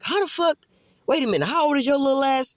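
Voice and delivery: high, incredulous outburst; shocked disbelief. Retold or imitated. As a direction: act stunned he'd even ask. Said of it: "I'm like".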